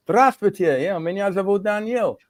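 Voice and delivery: high-pitched, chirpy self-introduction; eager greeting. Retold or imitated. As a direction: introduce yourself brightly, pitched well up. Acting out introducing himself to strangers.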